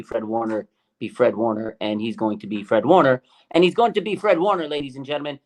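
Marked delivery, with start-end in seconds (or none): 3.5-4.7 raised, louder announcer-like hype; big hype-man declaration; emphatic proclamation. Real, not acted. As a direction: proclaim it high and loud to the crowd.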